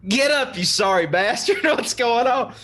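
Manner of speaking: hammed-up gruff wake-up with laughter; mock rough wake-up. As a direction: bark him awake while cracking up. Chief kicking sleeping coworker awake.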